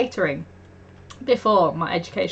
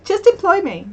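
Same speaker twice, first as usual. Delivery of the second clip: mock pleading; 8 semitones higher, 4 dB louder than usual. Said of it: "I'm just like".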